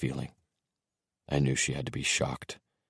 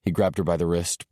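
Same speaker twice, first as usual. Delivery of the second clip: ordinary delivery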